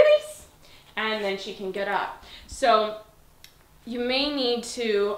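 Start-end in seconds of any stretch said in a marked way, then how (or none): 0.0-0.5 high loud release. Real, not acted. Trainer releasing her dog. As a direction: release called very high and louder.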